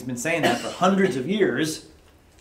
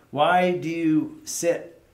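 slow pleading quote; 3 semitones higher, 4 dB louder, slower than usual. Retold or imitated. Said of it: "look at him".

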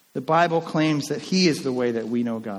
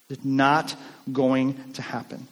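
ordinary delivery

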